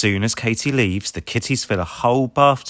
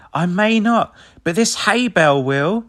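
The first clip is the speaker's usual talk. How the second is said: higher, louder, cheeky; confident reply; 10 semitones higher, 4 dB louder than usual. A narrator's reading. Rubble answering Ryder, higher and louder. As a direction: answer with a plan. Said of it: "says Rubble".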